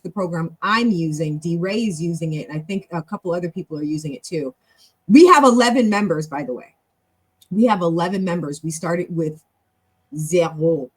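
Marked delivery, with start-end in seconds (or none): 5.1-6.3 proud, loud announcement; sudden loud burst of pride; excited announcement. Real, not acted. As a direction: burst out with it, loudly. Proudly announces the club's member count.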